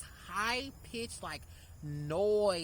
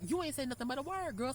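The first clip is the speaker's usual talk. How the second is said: high, sassy comeback; sassy retort; 7 semitones higher than usual. Retold or imitated. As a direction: toss it back with sass. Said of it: "I'm like".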